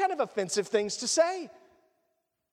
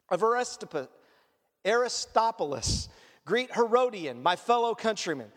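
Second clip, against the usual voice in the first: slightly lower, measured reading of the verse list; scripture reading; 4 semitones lower than usual. Acted. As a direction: read the names out steadily from the text. Reads scripture aloud.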